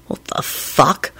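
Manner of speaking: slow, whispered disbelief; stunned whisper. Acted. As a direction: breathe it out in shock. Shocked man wakes to ghost.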